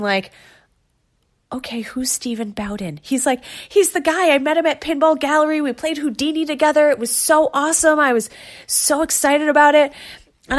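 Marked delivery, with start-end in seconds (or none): 1.5-3.0 breathy hush as if someone is sleeping nearby; whispered question. Retold or imitated. To her husband in bed: a soft whispered question. 3.7-9.9 higher, fast, eager fanboy voice; imitating excited husband. The husband gushing about his pinball hero: higher eager mock fanboy.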